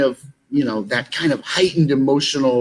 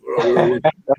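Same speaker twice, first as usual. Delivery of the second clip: gravelly [name]; 4 semitones lower than usual. Retold or imitated. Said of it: "[name] has this gravelly kind of voice".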